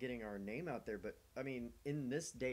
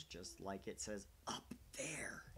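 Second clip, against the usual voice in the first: whisper; 3 semitones lower, 7 dB quieter, much slower than usual. Real, not acted.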